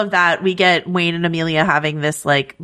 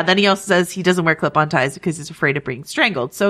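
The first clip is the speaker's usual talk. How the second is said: usual speaking voice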